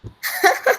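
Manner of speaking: high, quick giggle; real amused titter; short laugh. Real, not acted. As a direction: chuckle at a silly impression. Laughter after the old man act.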